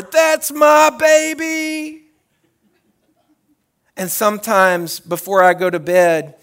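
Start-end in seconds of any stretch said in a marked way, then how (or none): 0.0-2.0 high, loud, slow holler; proud holler. Retold or imitated. Parent hollering at a school award, proud holler. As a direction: point and holler with chest puffed out. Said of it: "and I holler".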